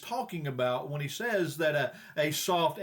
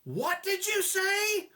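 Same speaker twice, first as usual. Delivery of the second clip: very high, drawn-out outraged wife; hammed-up indignant high voice; mock yell; 13 semitones higher, slower than usual. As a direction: screech it slowly in disbelief, without more volume. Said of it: "she yelled back".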